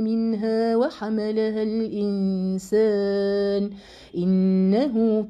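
slow, chanted tajweed recitation; Quran recitation. Acted. Teacher reciting the verse to her students, slow chanted recitation. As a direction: intone the verse, stretching the vowels.

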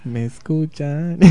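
slow, soft, seductive voice; sensual voice. Pretend. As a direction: purr it slowly and softly. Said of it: "recibir ahora a la voz sensual".

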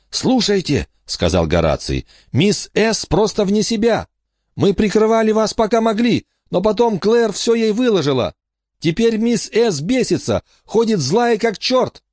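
worried boy spilling bad news; nervous boy Horace. Horace reports on the phone.

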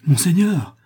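high, loud startled exclamation; alarmed cry. A narrator's reading. Two companions frightened by the prince's exaltation, alarmed cry. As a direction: cry out to check him, alarmed. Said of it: "s'écria à la fois Murph et Clémence, effrayés".

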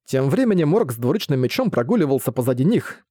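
ordinary delivery, nothing marked

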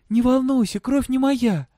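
put-on high, loud girl's voice; insistent girl. A narrator's reading. Girl reassuring her father about blood, insistent. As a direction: reassure him quickly in a high voice.

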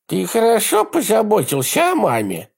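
put-on high, lisping, girlish man's voice; effeminate lisp. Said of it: "женоподобный шепелявый голос".

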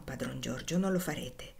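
calm him down under your breath; hushed. A narrator's reading. Tom hushing the boy. (sussurro sommesso e implorante)

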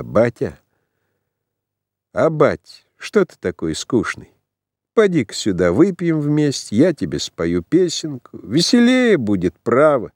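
put-on drunk son coaxing. A narrator's reading. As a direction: coax your father to drink. Drunk son coaxes father.

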